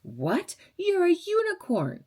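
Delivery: squealing "You're a unicorn" up high; gushing fan impression; mock gushing. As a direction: imitate an amazed fan, lightly. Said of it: "they go".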